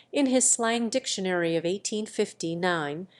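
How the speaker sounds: usual speaking voice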